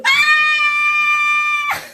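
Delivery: high, loud startled scream; panicked scream. Retold or imitated. Mom screams at the wheel when daughter startles her. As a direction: shriek in sudden panic. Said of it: "And I go into".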